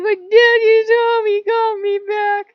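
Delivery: very high, loud, drawn-out mock wail; tearful joyful wail. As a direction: bawl it with overjoyed relief. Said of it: "You start crying tears of joy".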